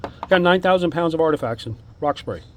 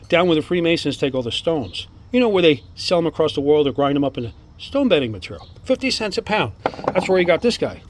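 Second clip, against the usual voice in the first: real excitement, pitch lifting on key words; eager, gushing show-and-tell; enthusiastic; 3 semitones higher than usual. Real, not acted. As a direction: marvel at the amazing finds. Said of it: "sorry about my enthusiasm here".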